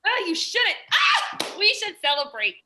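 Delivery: high, loud excited squeal; squealing delight. Acted. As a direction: burst with joy.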